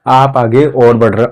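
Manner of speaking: ordinary talk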